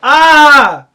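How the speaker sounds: sudden loud open-vowel cry; loud wailed "ah". Acted. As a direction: belt out a held "ah!". Sung cry in the song.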